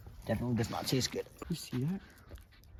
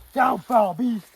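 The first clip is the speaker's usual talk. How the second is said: heroic warrior shout; 7 semitones higher, 8 dB louder than usual. Pretend. Pretend hero fights beast.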